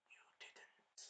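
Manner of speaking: breathless whisper; a viewer whispering in horror; shocked whisper. Retold or imitated. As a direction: breathe it in disbelief. My reaction to betrayal.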